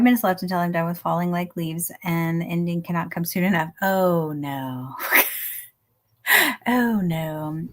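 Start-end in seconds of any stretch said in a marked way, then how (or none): none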